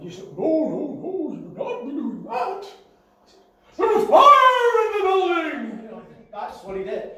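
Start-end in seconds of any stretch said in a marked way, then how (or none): 0.4-5.8 high and loud, building to a full shouted alarm; preacher's shout. Retold or imitated. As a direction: bellow the warning about the fire. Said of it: "He said".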